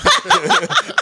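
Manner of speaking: a sudden squeal of a laugh; high squealing laugh. Real, not acted. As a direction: burst into a high giggle. Laughing at own joke.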